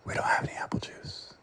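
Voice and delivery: hushed, unvoiced whisper, flat and cold. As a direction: whisper it right in his face. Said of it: "I whispered at him".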